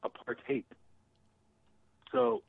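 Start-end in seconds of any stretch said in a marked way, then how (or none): 0.0-0.6 "apartheid" ending in the sound of "hate"; pronounced with "hate". Shown as a demonstration. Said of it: "It's hate".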